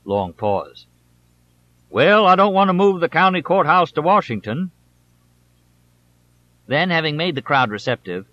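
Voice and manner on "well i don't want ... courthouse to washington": higher, louder hammed-up caricature voice; mock pompous politician. A narrator's reading. Congressman satirizing his opponent to the crowd, hammed up. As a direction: lampoon the stuffy old senator. Mocking the rival's evasive answer.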